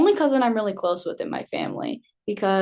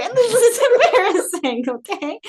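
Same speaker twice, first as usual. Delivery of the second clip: laughing; 11 semitones higher, 5 dB louder than usual. Real, not acted. Laughing at her own confession.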